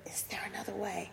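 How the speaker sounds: sudden hushed, voiceless whisper; a soft whispered prayer; whispered plea. Retold or imitated. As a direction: drop to a desperate whisper on the question. Jesus pleading in the garden.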